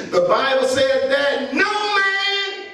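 slow, shouted sermon cadence; drawn-out preacher's cry with long pauses; preaching at full force. Acted. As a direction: hammer each line home to the congregation.

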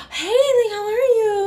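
squeaky high pretend-nothing greeting; fake cheery greeting. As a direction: play it innocent and overly bright. Faking casual greeting to roommate.

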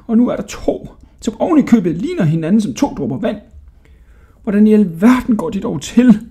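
put-on high, agitated old man voice; bewildered old jailer. A narrator's reading. Jailer baffled by two identical boys, high and flustered. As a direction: puzzle aloud in disbelief.

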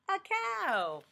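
ordinary talk, nothing marked

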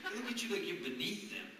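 ordinary talk

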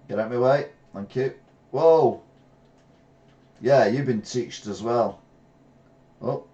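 usual speaking voice

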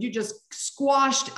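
ordinary talk, nothing marked

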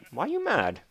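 high-pitched, startled question; high surprised question. Acted. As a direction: squeak it out, surprised. Talking to a mob.

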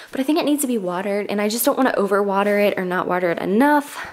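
ordinary talk, nothing marked

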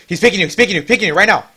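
loud, high warning shout; urgent callout. Real, not acted. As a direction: yell the warning fast.

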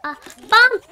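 sudden loud startled cry; startled shout. Real, not acted. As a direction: yelp at the surprise. Surprised by a game bomb.

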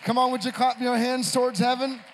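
loud, high, building pulpit cry; full-force preaching prayer. Real, not acted. Preacher leading congregation to honor Jesus, fervent full-force prayer.

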